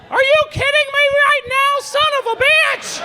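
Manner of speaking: very high, loud squeaky cartoon-mouse yell; mock dad's screaming in a mouse falsetto; Mickey Mouse dad. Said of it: "I would just repeat, like, whatever he said".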